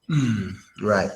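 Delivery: usual speaking voice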